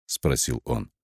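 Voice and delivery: plain reading voice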